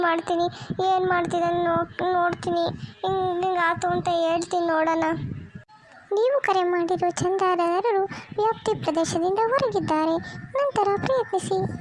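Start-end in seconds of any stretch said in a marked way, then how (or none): 6.1-11.7 automated phone announcement; recorded operator voice. Acted. Operator telling caller the husband is unreachable, recorded-announcement voice. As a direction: recite it like a network's recorded message.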